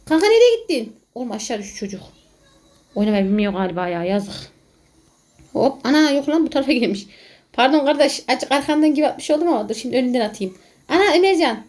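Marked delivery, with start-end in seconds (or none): none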